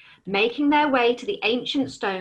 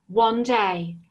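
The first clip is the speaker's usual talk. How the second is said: ordinary delivery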